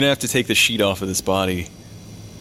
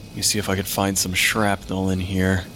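ordinary delivery

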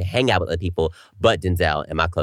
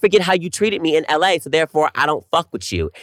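louder, higher defiant retort; firm, raised retort to an old bully; retold telling-off; 8 semitones higher, 4 dB louder than usual. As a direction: put him in his place. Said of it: "I'm like".

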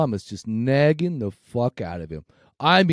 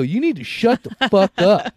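louder, higher, slow mock shout; reliving the outburst, raised and slow; retold angry yell; 6 semitones higher, 5 dB louder than usual. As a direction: bark it back at the nagging mom. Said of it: "I go".

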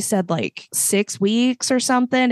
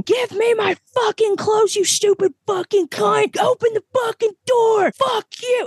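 mock drunk rage, pitched way up; furious drunk screaming; 14 semitones higher than usual. Drunk guest pounding on the door, screaming high.

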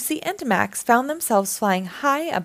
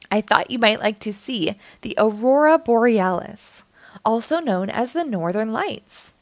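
ordinary delivery, nothing marked

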